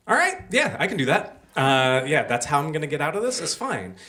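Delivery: put-on thirteen-year-old boy relieved, eagerly agreeing with old Jack; eager relief. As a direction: jump at the easy way out.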